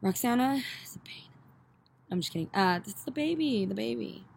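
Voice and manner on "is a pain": teasing stage whisper; breathy joking aside. Pretend. Teasing aside about family member.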